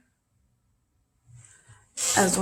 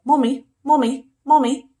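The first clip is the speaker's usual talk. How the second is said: higher, louder needy calling; cat calling mommy; 6 semitones higher, 9 dB louder than usual. Pretend. Teacher voicing the cat calling for his mom, higher and louder. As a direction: whine for his mom, pitched up. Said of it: "he loves his mom".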